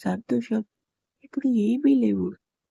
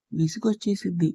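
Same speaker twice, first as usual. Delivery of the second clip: plain reading voice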